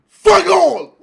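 loud burst of disgust; loud, disgusted outburst; angry shout. Real, not acted. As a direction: shout it out, fed up. Finds nothing in the pack.

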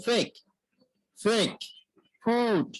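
ফেক and ফুট opening on a breath-blown F; ফেক, ফুট said singly with a clear F; demonstrating F. Shown as a demonstration. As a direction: model the lip-friction F starting ফেক, ফুট. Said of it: "এই ফর ক্ষেত্রে হচ্ছে".

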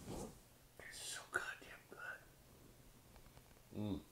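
hushed, very slow whisper; drawn-out, voiceless murmur of pleasure; savoring whisper. Real, not acted. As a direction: whisper it slowly, relishing the drink. Savoring a sip of beer.

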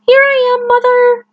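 high, loud chick calling up to the plane. Acted. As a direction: shout up to the sky, little bird. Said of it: "he called out".

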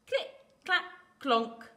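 loud, separated sound-effect words; knocking sound words. A narrator's reading. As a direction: make each word a loud knock. Narrator imitates acorn's knocking.

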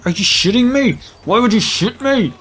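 high-pitched, indignant mock outrage; rising indignation at being shot, half in play; put-on anger. Player confronts whoever is shooting at him, mock angry. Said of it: "I was getting angry at no one there".

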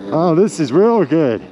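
ordinary talk, nothing marked

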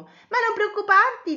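high, loud, eager reassurance; lightly voiced reassuring girl. Betty reassures Cosmo at the cave, eager reassurance.

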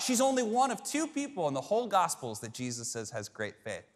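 normal talking voice

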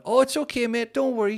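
put-on overly polite dad to a bossy kid; forced nice. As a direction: sweeten it through clenched teeth. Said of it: "trying to be all like".

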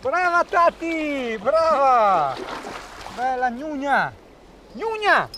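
very high, excited cheering; urging the dog, rising with excitement; talking to a dog. Real, not acted. (incitando il cane, eccitata)

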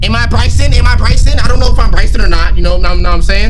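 loud, high-pitched questioning; agitated. Real, not acted. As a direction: blurt it out loudly in a rising panic. Panicking over his identity in game.

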